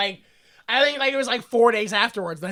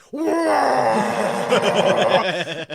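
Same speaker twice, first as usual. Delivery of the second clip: howling laugh. Real, not acted. Long laugh at the twist.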